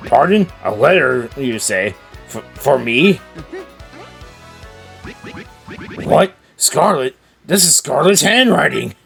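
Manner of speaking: put-on startled old sailor; suddenly shocked at the letter; surprised.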